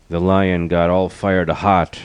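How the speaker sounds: usual speaking voice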